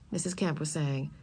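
usual speaking voice

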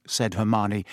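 ordinary delivery

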